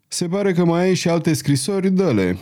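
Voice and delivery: ordinary delivery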